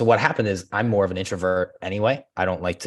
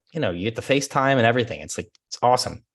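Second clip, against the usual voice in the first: ordinary talk, nothing marked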